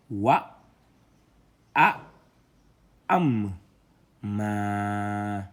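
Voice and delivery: loud, drawn-out syllables read one by one; syllable reading drill. Shown as a demonstration. Teacher spelling out syllables loudly for students. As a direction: sound out each letter for the class.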